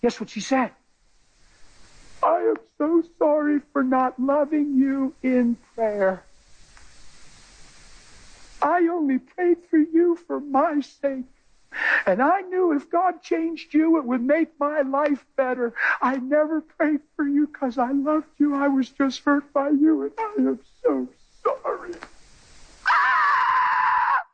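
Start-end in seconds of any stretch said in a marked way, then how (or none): 2.2-21.9 slow, high, tearful voice; tearful confession. Retold or imitated. 22.8-24.2 loud high cry out; anguished cry. Real, not acted. Shout after emotional quote.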